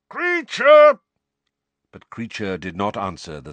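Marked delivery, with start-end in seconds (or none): 0.1-1.0 much higher and louder, falling yell; second, angrier shout for the elf; full bellow. Acted. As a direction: roar his name as loud as you can. Sirius calling the elf again.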